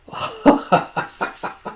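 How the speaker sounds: short unvoiced burst of laughter; wheezy laugh; real laugh. Real, not acted. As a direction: chuckle breathlessly at the story. Storyteller laughs at the line.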